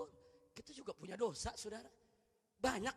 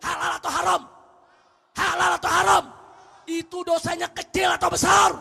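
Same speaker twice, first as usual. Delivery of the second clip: high, loud preaching shout; khotbah berapi-api; 6 semitones higher, 19 dB louder than usual. Real, not acted. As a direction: thunder the challenge at the congregation. Preaches at full force. (khotbah lantang dengan nada tinggi)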